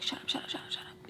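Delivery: a soft whispered mock of being told to shut up; mock hushing. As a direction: hiss it under your breath like a sneer. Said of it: "a quick way to be like".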